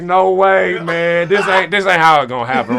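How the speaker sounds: high, pleading disbelief; retold panic. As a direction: act out refusing to believe it. Retells panic she had died.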